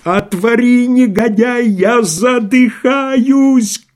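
сиплый крик, очень высоко и громко; хрипло кричать, задыхаясь. Acted. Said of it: "крикнул он сиплым голосом". (Рагин сипло кричит в дверь, задыхаясь)

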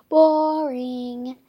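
loud, drawn-out bored groan. A narrator's reading. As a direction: let the word sag with boredom. Judy's disappointed verdict.

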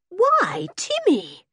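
high surprised cry; amazement. Acted. Girl finding the dog on her bed, high amazed cry. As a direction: gasp his name in wonder. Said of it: "said Henry in amazement".

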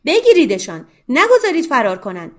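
فریاد بلند و بالا از ته سالن; angry shout. Acted. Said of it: "فریاد بزند". (فریادی بلند و خشمگین)

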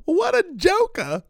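very high, drawn-out mocking squeal; old dude's funny line. Podcaster imitating an old online clip, high and slow. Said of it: "some old dude online that, like, years ago did that funny".

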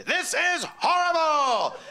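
high, slow panicked yell; hammed-up cry of dismay at the crowd; mock panicked shout. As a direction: blurt it out in horror. Panicked aside to sister at funeral.

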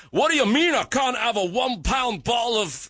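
put-on a deliberately clumsy Scottish accent; bad Scottish accent. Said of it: "that's a bad Scottish accent".

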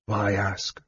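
soft, worn-out reply; weary. A narrator's reading. Tired mage answering the half-elf's demand, softly weary. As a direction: ask it quietly, with little strength left. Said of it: "Raistlin said wearily".

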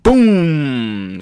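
long, loud boom falling in pitch; booming impact imitation. Narrator voicing a hit that knocks Frankie back, drawn-out boom. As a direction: draw out the boom like an explosion.

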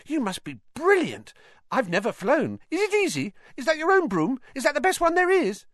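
high piping, gushing questions; eager schoolboy fan. A narrator's reading. Colin pesters Harry about flying and brooms, excited. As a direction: pile on excited questions in a boyish treble.

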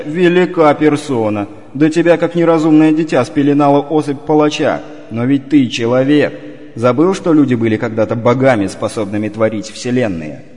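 scornful scolding. Acted. Inner voice scolding Ivar.

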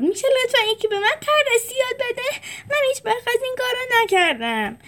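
put-on young zebra Julius pleading to learn tricks. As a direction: ask timidly but quickly, like a nervous kid.

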